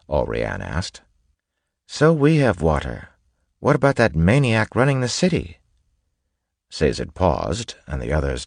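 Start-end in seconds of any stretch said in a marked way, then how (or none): 1.9-5.6 high-pitched, impatient woman's voice; impatient young noblewoman. Acted. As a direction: challenge them in a voice pitched well up. Alrian impatiently challenges the group.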